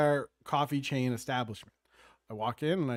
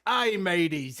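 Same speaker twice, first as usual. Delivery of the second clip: lightly voiced pirate greeting; a hint of a pirate captain; 3 semitones higher, slower than usual. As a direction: hail the crew like a pirate. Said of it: "here I am on a pirate".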